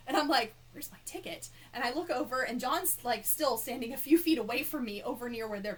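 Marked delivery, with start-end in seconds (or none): 0.7-1.5 hushed unvoiced puzzled question; confused whisper. Fan echoing the staff's question, confused whisper. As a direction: whisper it, baffled.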